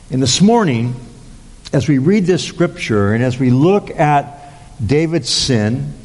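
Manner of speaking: ordinary talk, nothing marked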